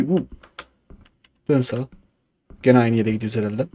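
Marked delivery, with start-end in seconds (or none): none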